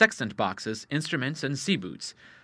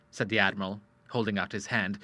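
usual speaking voice

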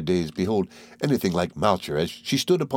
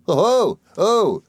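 very high, loud oh; little creature's cry; 12 semitones higher, 5 dB louder than usual. Acted. Mowcher cries out at the guests, very high. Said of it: "cried the little creature".

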